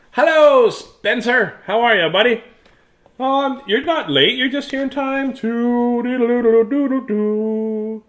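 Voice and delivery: light up greeting a friend; cheery greeting. Real, not acted. Greets Spencer joining live.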